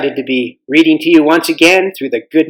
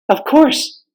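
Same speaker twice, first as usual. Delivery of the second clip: put-on eager girl; 10 semitones higher than usual. Girl voiced in story.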